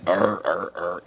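deep, soft arr, arr growls; a quiet discontented undertone; low grumbling noise. Shown as a demonstration. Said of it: "a low undertone".

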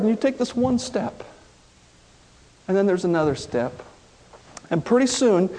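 usual speaking voice

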